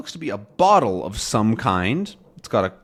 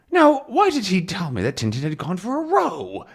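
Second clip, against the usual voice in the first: put-on high, puzzled old man voice; confused old professor; 9 semitones higher than usual. Calculus puzzling over what he misheard, high confused voice. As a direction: wonder aloud, bewildered and high.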